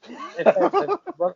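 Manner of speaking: stammering through a burst of laughter; can't get the words out for laughing. Real, not acted. Father retelling his daughter's line, laughing.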